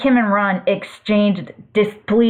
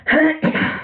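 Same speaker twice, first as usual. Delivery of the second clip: throat clearing; 8 semitones higher than usual. Real, not acted. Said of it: "my throat was feeling a little dry".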